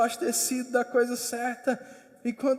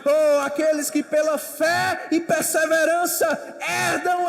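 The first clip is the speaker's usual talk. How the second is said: preaching at full force; 5 semitones higher, 9 dB louder than usual. Acted. Preacher exhorts the congregation to persevere, loud and rising. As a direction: preach it out to the hall.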